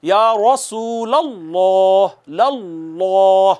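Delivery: या रसूलल्लाह drawn out with a thick, heavy लल्लाह; heavy recitation. Shown as a demonstration. Teacher has students repeat after him, heavy lam drawn out. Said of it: "फिर से कहिए मेरे बाद".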